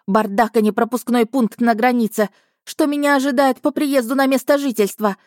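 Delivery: indignant tirade. Acted. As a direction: let loose a ranting complaint. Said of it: "разошлась скандалистка".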